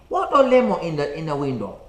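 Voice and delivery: lightly imitated Chinese-accented question; broken English in a put-on Chinese accent; mock Chinese accent. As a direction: play the puzzled Chinese customer, starting loud. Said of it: "and said to me".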